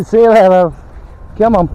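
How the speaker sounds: calling out. Real, not acted. Parting, calling dog.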